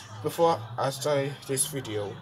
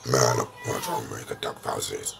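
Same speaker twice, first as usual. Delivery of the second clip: much lower voiced sidekick; low puppet voice; 12 semitones lower than usual.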